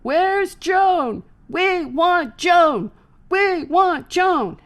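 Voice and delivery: loud, slow, high demanding shout; crowd shouting. Retold or imitated. Balcony crowd demanding Joan, loud slow shout. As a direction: yell it like an angry fan.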